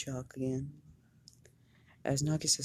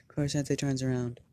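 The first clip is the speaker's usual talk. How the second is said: usual speaking voice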